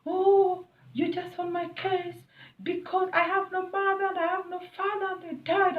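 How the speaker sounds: high, whining mock plea; mimicking the husband's sob story, high and whiny. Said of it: "he started telling me".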